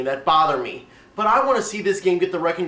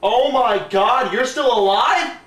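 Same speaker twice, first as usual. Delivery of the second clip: shocked; 5 semitones higher, slower than usual. Acted. Villain returns unexpectedly.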